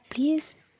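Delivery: soft, breathy whispered please; a tender whispered plea to let her help; lovingly pleading. A narrator's reading. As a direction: coax her gently, almost a whisper. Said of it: "इतने प्यार से कहती है".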